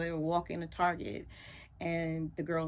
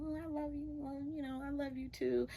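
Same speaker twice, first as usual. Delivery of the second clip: overheard sweet talk; 7 semitones higher, 10 dB quieter than usual. Retold or imitated. Overheard son on phone.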